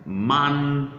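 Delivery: "Monday" drawn out as "Mon... day"; syllable by syllable. Shown as a demonstration. Teacher breaking the word down for learners, slow split syllables. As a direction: hold "Mon" long and level, then "day". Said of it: "how to pronounce days of the week".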